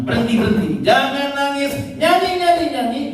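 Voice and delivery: seruan tinggi dan keras, lalu turun; suara Tuhan menegur. Retold or imitated. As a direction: serukan perintah menghibur dengan lantang. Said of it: "Tuhan bilang". (suara Tuhan yang berseru tegas)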